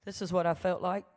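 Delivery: usual speaking voice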